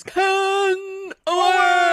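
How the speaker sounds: big booming ring announcer call; wrestling announcer. Retold or imitated. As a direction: belt out the name like a ring announcer. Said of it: "the wrestler announcer where it goes".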